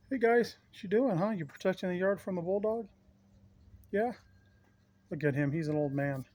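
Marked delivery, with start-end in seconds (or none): 0.1-4.1 high, bright pet talk; talking to the dogs. Real, not acted. Greeting his dogs in yard.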